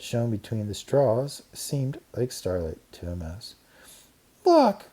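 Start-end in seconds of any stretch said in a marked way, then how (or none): 4.4-4.8 loud, very high shriek. A narrator's reading. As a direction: shriek to point it out. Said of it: "shrieked Karen".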